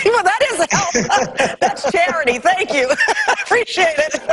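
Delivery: words bubbling through real laughter, pitched high; amused, laughing between words. Real, not acted. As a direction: chuckle through the thanks. Host laughs at guest's joke.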